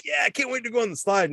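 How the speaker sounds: put-on high, fast eager kid; excited kid voice. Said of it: "all these kids like".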